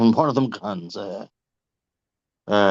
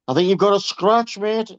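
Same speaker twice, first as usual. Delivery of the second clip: higher, louder mock concern about the scratch; a cheeky higher-pitched jab at a passing driver; cheeky quip; 8 semitones higher, 6 dB louder than usual. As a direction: rib the driver with fake concern. Cheeky remark to passing scallies.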